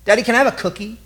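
put-on high little boy pleading; a child asking his daddy, pitched high. Little boy begging his deployed father for a cookie, childlike. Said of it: "He just keeps saying things like".

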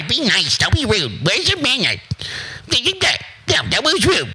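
high nagging mother voice; high-pitched nagging mom; mocking nagging mom. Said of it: "telling you to be nice".

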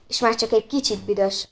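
usual speaking voice

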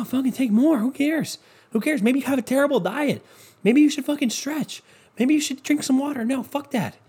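high, mocking, egging you on; sarcastic mock voice. Mocking people who pop pills, high sarcastic voice. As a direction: sneer at pill takers sarcastically. Sarcastic rant voicing pill mindset.

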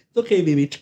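high cooing through laughter; soothing a scared dog. Retold or imitated. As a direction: coo to it like a nervous pet. Guard soothing pistol, laughing.

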